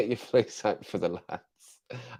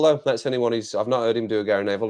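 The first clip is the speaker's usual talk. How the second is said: ordinary delivery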